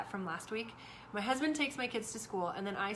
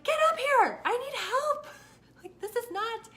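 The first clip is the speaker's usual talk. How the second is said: much higher, much louder furious command; angry yell; 13 semitones higher, 9 dB louder than usual. Retold or imitated. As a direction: order him upstairs now. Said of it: "I'm like".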